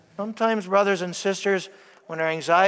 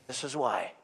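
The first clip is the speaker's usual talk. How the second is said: "This is why" said again much softer; softer repeat; 7 semitones lower, 11 dB quieter than usual. Shown as a demonstration. Preacher repeating his point to the congregation, softer. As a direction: let "This is why" drop to a quiet echo.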